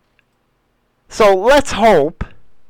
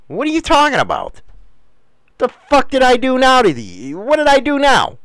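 exasperated protest; 6 semitones higher than usual. Retold or imitated. Retelling his baffled protest to complainers.

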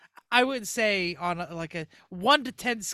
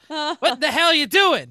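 slow, louder Southern cop drawl with a laugh; Southern accent; 6 semitones higher, 9 dB louder, slower than usual. Retold or imitated. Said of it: "Don't you dare a Southern accent".

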